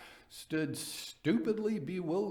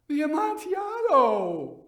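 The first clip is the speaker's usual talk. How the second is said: high, loud, startled cry; astonished exclamation; 9 dB louder than usual. Acted. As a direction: blurt it out in shock, much higher and louder. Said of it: "ejaculated my friend".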